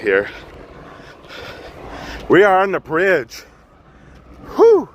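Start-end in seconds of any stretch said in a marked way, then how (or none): 4.6-4.9 loud, high breathless blow-out; winded whew. Real, not acted. Vlogger filming a jumper scene, out of breath, exhales loudly. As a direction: puff out hard after the climb. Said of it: "I'm out of breath".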